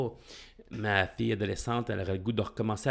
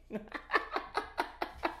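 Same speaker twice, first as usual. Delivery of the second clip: soft breathy laugh; 11 semitones higher, 9 dB quieter than usual. Real, not acted. Laughing at own mistake.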